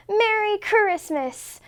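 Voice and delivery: high, slow, excited greeting; excited cry. A narrator's reading. Mouse greeting Bear at the door, high and excited. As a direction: Mouse bursting with Christmas cheer. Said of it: "cried Mouse".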